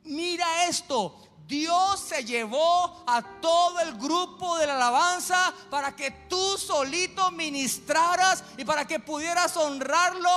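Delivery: loud, high, drawn-out scolding; acting out a forceful telling-off; impassioned rebuke. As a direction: drive the reproach home at full preaching force. Acted face-to-face rebuke of the preacher.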